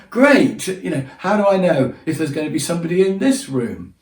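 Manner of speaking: high, sarcastic great with a laugh; sarcastic worry. Retold or imitated. To himself at reception, high sarcastic dismay. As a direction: grumble with ironic dismay. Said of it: "And I just thought".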